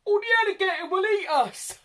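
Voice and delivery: very high, loud squeaky mouse voice; squeaking mouse. A narrator's reading. Mouse squeaking fearfully about the alligator. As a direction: squeak out the fear like a tiny mouse. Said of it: "squeaked the mouse".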